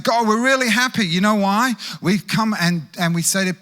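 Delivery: high, loud, gushing with joy; giddy disciples, loud and high; excited disciples. Retold or imitated. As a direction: play the thrilled disciples bragging. Pastor voicing excited disciples.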